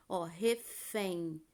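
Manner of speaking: refém said very slowly, em as a nasal glide; slow word demo. Shown as a demonstration. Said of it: "são falados como ditongos".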